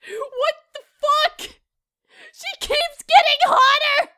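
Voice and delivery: high, tearful mock-angry wail; mock anger. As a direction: wail it in exasperated disbelief. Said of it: "I'm so fucking angry".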